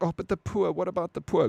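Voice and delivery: mock the phony do-gooders; mock pity. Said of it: "Everyone's like".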